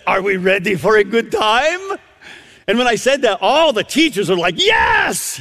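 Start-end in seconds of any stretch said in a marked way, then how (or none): 0.1-2.0 imitated grandmotherly Romanian accent, lifting at the end. Grandmother to strangers at the elevator, imitated Romanian accent. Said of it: "she says in this unique accent". 4.6-5.4 high, imitated crowd shout; excited cheer. Teachers answering the preacher all together, excited shout.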